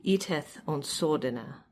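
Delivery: put-on slow, measured reading of an old riddle, each half line set apart; Old English recitation. Shown as a demonstration. Scholar reads an Exeter Book riddle aloud in slow recitation. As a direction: recite the alliterative verse slowly with pauses between half lines. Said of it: "So this is, uh, number seventy-seven".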